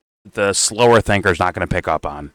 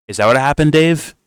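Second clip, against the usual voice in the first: ordinary talk, nothing marked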